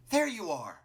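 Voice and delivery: high, loud character call. Acted. Martlet finds Clover on the mountain, calling out loudly. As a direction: call out sharply on finding someone.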